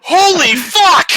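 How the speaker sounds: very high, drawn-out shout; full-blast acted shriek of shock; starstruck scream. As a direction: imitate a fan screaming in disbelief. Acting out the stunned fan's reaction.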